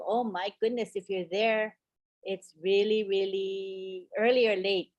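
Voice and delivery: usual speaking voice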